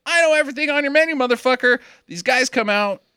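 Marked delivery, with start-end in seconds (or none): 0.1-1.8 high, fast smug brag; high cocky voice. Retold or imitated. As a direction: squeak it out cocky. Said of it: "he's like".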